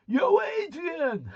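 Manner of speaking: put-on high, loud, drawn-out Rocky-style holler in a dodgy accent; hammed-up Rocky accent shout. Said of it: "in a dodgy accent".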